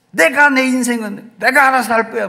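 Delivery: preacher mimicking a rebellious teen to parents; acted teenager. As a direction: slam the door on your parents with it. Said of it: "막 이렇게 하죠".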